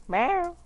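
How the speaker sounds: high, loud playful meow; cat meow. Pretend. Imitating a cat to friend.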